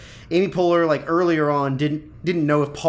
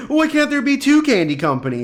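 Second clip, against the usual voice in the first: imitating a pleading plea, pitched higher; 9 semitones higher than usual. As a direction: ask it high and pleading, like a plea. Retelling a character's plea.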